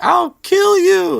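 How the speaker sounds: very high, loud, slow threatening shriek; Filch's screech. Retold or imitated. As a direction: scream the threat with all you have. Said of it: "and he's like".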